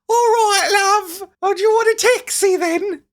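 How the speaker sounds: high, hammed-up English accent; mock English accent. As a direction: put on a fake-sounding English accent like a cheerful cabbie. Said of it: "Sounds like when I do a voice".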